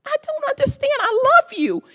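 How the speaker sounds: high, pleading cries of why; emotional pleading. Real, not acted. Resident pleading with the mayor, high and emotional. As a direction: beg them to understand, near tears. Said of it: "But I am just so emotional".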